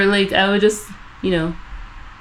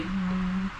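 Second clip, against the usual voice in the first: ordinary talk, nothing marked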